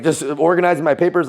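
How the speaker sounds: ordinary delivery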